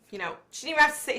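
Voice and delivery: fast, tumbling words; quick exasperated aside; rushed aside. Real, not acted. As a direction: rattle it off quickly. Fast rant.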